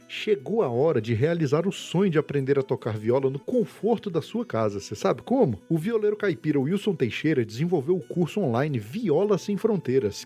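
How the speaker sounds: upbeat promo voice; ad read. Acted. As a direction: sell it like a radio spot. Host reads sponsor ad.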